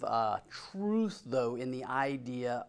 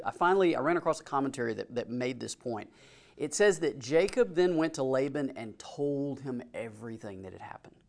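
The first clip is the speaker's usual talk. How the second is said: usual speaking voice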